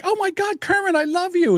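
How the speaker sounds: put-on very high squealing girl voice; high giddy girls swooning over Kermit; gushing girls.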